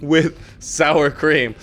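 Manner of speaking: joking customer. Retold or imitated. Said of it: "I'm just asking, like".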